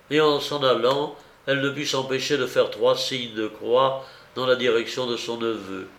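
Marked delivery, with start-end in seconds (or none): none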